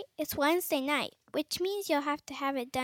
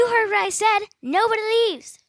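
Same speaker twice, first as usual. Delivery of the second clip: loud, higher, stern threat; angry refusal; 5 semitones higher, 8 dB louder than usual. Acted. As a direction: lock them in with your voice. Monster teacher refuses.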